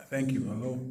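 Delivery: normal talking voice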